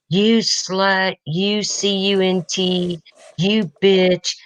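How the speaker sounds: hammed-up rant of a furious stranger, pitched high; imitating angry caller. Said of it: "the person on the end is like".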